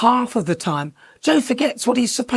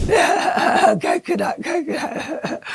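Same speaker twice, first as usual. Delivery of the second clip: laughing echo; 9 semitones higher, much slower than usual. Retold or imitated. Echoing the coconut tree clip.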